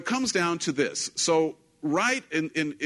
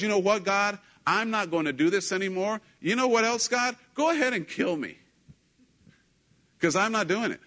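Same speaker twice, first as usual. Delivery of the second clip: higher-pitched, fed-up outburst; an exasperated challenge to God, acted out; irritated; 6 semitones higher than usual. Prophet telling God he will not go on, irritated. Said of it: "he gets so irritated".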